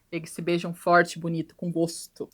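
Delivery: ordinary talk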